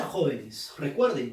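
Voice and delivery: ordinary talk, nothing marked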